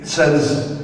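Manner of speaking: ordinary talk, nothing marked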